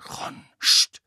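geflüstertes, scharfes Scht; verängstigtes Zischen. A narrator's reading. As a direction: zisch ihn ängstlich flüsternd an. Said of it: "blickte sich verängstigt um". (Hermine bringt Ron ängstlich flüsternd zum Schweigen)

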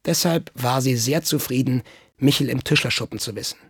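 usual speaking voice

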